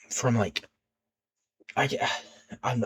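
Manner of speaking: nervous stutter, words catching. Real, not acted. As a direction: stumble over the words. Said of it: "I'm stuttering here".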